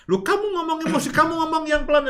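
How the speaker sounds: high, fast, annoyed retort; irritated demo. Shown as a demonstration. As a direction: snap back at an upset partner. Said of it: "Bukan itu". (balasan ketus, tinggi dan cepat)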